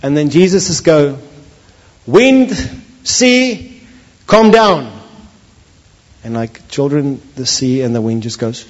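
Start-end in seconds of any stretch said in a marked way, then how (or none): 2.1-4.9 high, loud rebuke; commanding shout. Acted. Jesus at the stern ordering wind and sea, in a loud high command. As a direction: command the storm to obey.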